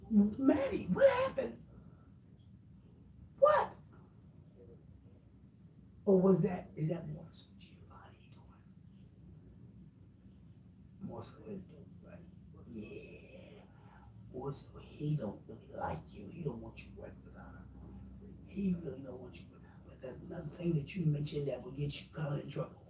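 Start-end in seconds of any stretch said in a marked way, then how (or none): 0.1-3.7 squealed, much higher pitched disbelief, climbing on each word. Real, not acted. To Maddie on the stream, asking what happened: shrill disbelief. 6.8-22.8 hushed, much softer whispered aside; secret whisper. Real, not acted. Telling Maddie why he doesn't want her working with Chi Chi: whispered. Confiding aside about Giovanni.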